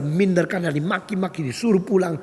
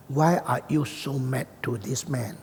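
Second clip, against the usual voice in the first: slow, softer, measured question; calm questioning; 3 semitones lower, 5 dB quieter, slower than usual. Retold or imitated. Preacher approaches angry Dutch woman, slow quiet question. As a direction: ask gently and slowly to calm someone.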